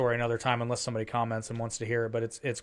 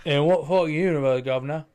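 loud, hammed-up British accent; terrible British accent; 3 semitones higher, 7 dB louder than usual. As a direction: put on a bad London British accent. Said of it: "It's a terrible British accent".

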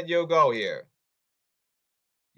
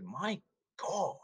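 whispered quote; 4 semitones higher, 14 dB quieter, much slower than usual. Retold or imitated. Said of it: "Like Bob Buck said".

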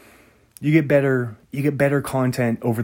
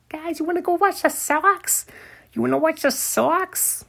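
put-on New England accent; 8 semitones higher than usual. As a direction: call the guys to Fenway in a thick Boston accent. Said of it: "that's my New England accent".

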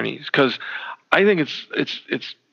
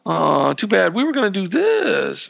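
high, loud mocking voice; sing-song fake regret; mocking relatives; 6 semitones higher, 4 dB louder than usual. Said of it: "they're like".

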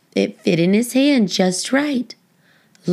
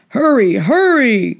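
louder urgent call, a touch higher; 4 semitones higher, 5 dB louder than usual. A narrator's reading. Fire chief rushing the firefighters out, urgent call. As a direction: hurry the crew along. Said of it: "called the fire chief".

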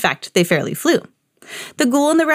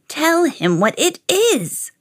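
put-on slow, high old woman voice; high, drawn-out old woman; old woman suggesting; 8 semitones higher, slower than usual. A narrator's reading. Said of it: "suggested the old woman".

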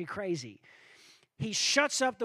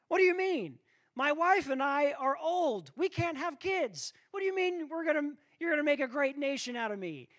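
high, fast, disbelieving protest; incredulous protest; 8 semitones higher than usual. Acted. Old Abraham protesting to God, high fast disbelief. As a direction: rattle off his doubts in disbelief.

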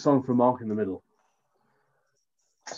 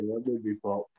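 ordinary talk, nothing marked

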